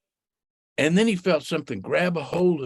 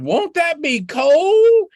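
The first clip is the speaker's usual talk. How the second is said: high, louder, slow worried squeak; worried Rabbit; 11 semitones higher, 7 dB louder, much slower than usual. Acted. Rabbit fears the icy water, high and slow. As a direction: drag it out, high and fretful.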